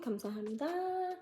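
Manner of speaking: soft Korean "Kamsahamnida", said quietly; "Kamsahamnida", breathed out with a bow; Korean thank-you. Retold or imitated. Thanking a shopkeeper in Korea, softly. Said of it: "I just end it with a bow".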